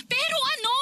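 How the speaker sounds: high, urgent repeat; frantic high plea; desperate pressing. Acted. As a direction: push harder, pitch rising.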